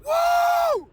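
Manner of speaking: high, loud joyful whoop; ecstatic whoop. Real, not acted. Morning preacher whooping for his viewers, ecstatic whoop. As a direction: let out a wild happy whoop. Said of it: "when I get super excited".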